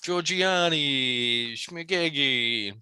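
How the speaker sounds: high, slow, hammed-up Italian drawl; mock Italian accent. Italian waiter refuses the customer, in a slow, high, hammed-up Italian accent. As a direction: stretch the fake Italian words out slowly. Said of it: "Chef Boyardee voice".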